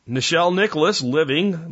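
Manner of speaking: ordinary delivery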